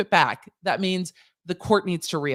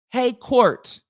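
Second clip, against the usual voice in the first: a mock call, pitched up; 6 semitones higher, 5 dB louder than usual. Addressing the court in a mock call-out. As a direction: call out to the court, a bit higher.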